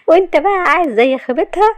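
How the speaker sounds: high, incredulous echo, rising at the end; mocking question. Shahd teasing Kamal with his own phrase, mocking. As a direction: throw the words back in a high voice.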